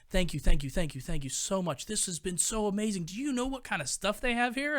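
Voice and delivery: overflowing gratitude mid-hug; gushing thanks. Retold or imitated. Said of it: "huge bear hug and goes".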